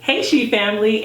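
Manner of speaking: ordinary talk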